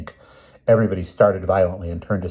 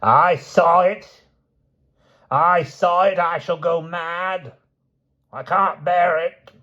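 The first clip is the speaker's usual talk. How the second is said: put-on much higher, slow hysterical shriek of an old woman; shrill screaming; 10 semitones higher, slower than usual. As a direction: scream it out, going mad with terror. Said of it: "shrilly screaming".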